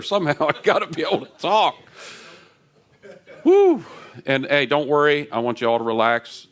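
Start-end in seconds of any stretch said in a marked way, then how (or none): 3.4-3.8 high, loud joyful woo; excited whoop. Real, not acted. Pastor wrecked by worship, loud high joyful whoop. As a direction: let out a happy cheer.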